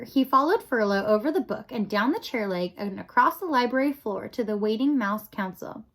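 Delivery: ordinary delivery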